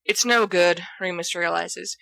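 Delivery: plain reading voice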